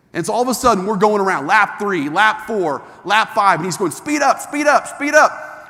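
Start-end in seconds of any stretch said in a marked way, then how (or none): 3.9-5.7 higher, louder urgent speed up; urging shout. Retold or imitated. Instructor yelling at the driver on the speedway, higher and louder. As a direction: push him to floor it.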